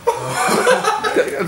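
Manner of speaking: laughing. Real, not acted. Laughing at friend's reaction.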